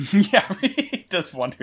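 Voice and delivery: laughing through the words. Real, not acted. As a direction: giggle while saying it.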